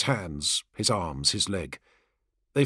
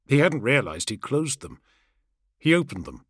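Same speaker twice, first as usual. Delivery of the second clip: usual speaking voice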